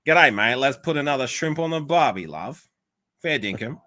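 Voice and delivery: broad, hammed-up Aussie accent. Shown as a demonstration. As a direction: lay the Australian accent on thick. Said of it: "the stereotypical way to say it".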